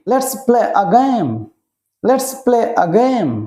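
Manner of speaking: very slow, every word drawn out for learners; drawn-out classroom model; slow teaching repeat. Shown as a demonstration. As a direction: spell it out slowly so children can follow. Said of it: "repeats slowly for learners".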